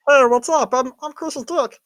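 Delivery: high squeaky silly voice; talking dick voice. Pretend. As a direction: play a tiny talking body part. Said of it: "if my dick could talk".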